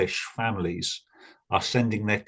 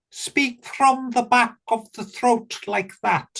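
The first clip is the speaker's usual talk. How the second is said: North Wales accent, much higher, from the back of the throat; 12 semitones higher than usual. Shown as a demonstration. Welshman showing a listener the North Wales accent, high and throaty. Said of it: "This would be North Wales".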